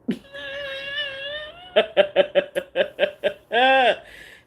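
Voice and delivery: high laugh close to crying; high teary laughter. Real, not acted. Laughing at getting the boot, teary laughter. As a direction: laugh till it almost cries.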